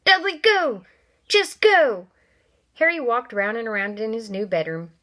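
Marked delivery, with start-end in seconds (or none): 0.0-2.1 high, loud yell; loud frantic yell. Acted. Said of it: "he yelled at Henry".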